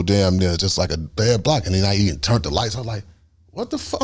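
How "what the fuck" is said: very high, softer panicked voice; retold panic. Passenger seeing the lights go off, high panic. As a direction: re-enact alarm in a high, small voice. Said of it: "now it's panic sweat".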